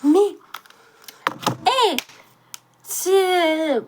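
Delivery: startled outburst at the ad; indignant surprise. Real, not acted. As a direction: jump up in pitch, outraged.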